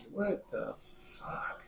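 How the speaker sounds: slow, drawn-out whisper of disbelief; hushed, stretched-out exasperation; stunned whisper. Retold or imitated. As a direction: whisper it slowly, utterly baffled. Retold reaction to angry driver.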